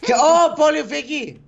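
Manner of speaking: urgent call. Pretend.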